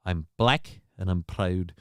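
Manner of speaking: low, soft mock Scottish; imitated Scottish accent. Streamer wishing for a sound drop of that dude, lightly Scottish. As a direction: toss off the quote lightly Scottish. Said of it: "I need that dude saying".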